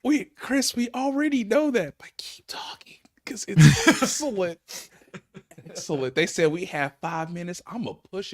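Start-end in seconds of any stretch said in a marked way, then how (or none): 2.0-3.0 whispered aside; hushed urging whisper. Real, not acted. Aside to host mid-show. 3.2-4.9 laughing. Real, not acted. Laughs mid-word.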